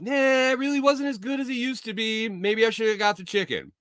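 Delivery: high, let-down grumble; mock disappointed whine. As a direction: whine about the burger regretfully. Disappointed complaint in raised voice.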